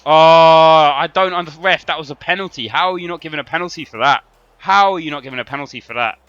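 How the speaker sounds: loud, high indignant complaint at the referee; really furious, pleading with the ref; outraged protest. Real, not acted. Protesting penalty call at ref.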